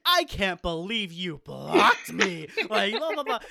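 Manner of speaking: drawn-out, loud, high mock complaint; mock outraged whine. Imitating a friend's wounded wail at being blocked. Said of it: "I so vividly remember you being like".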